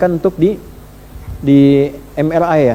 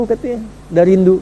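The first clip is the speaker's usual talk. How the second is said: ordinary talk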